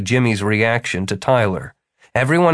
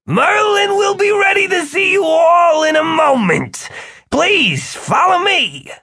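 very high, over-cheerful host voice; forced joviality; 3 dB louder than usual. A narrator's reading. Eddie composes himself for the wizards, forcedly jovial. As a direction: push the good cheer far too hard. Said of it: "then with forced joviality said".

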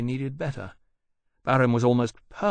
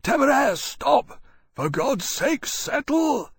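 high hoarse desperate shout; hoarse call; 14 semitones higher, 4 dB louder, slower than usual. A narrator's reading. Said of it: "called out to him hoarsely".

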